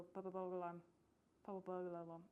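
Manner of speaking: "Purple burglar alarm" in Scottish, rolled and clipped like "pupa poga larm"; Scottish accent. Shown as a demonstration. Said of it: "to do a good Scottish accent, you have to go".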